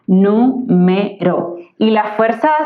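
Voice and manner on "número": "Número" very slow, nú, me, ro; syllable by syllable. Shown as a demonstration. Teacher confirming three syllables for students, "Número" slowly syllable by syllable. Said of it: "tiene tres sílabas".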